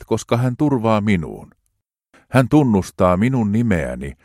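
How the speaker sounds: hartaasti, rauhallisesti luettu psalmi; juhlallinen psalmin luenta. Acted. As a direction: lue kuin rukousta jumalanpalveluksessa. Reads psalm aloud solemnly. (juhlallinen raamatunluku)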